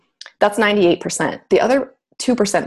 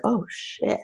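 much softer, muttered dismay; hushed realization; 3 semitones higher, 7 dB quieter than usual. Retold or imitated. Realizing her pattern in the car, hushed dismay. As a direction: let it slip under your breath.

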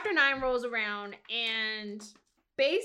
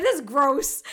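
disgusted; 3 semitones higher, 8 dB louder than usual. Retold or imitated. Disgusted reaction.

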